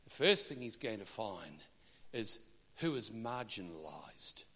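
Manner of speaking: ordinary delivery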